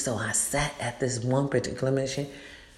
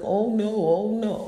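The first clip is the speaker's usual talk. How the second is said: higher, mimicked protesting innocence; mock denial; 7 semitones higher, slower than usual. Shady people confronted by her, mock-innocent denial. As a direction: imitate a schemer caught and denying it.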